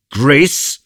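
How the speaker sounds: high, loud affronted question; proud, incredulous outburst; haughty indignation. A narrator's reading. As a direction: throw the word back, offended. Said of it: "pronounced by the haughty musketeer".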